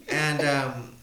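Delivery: usual speaking voice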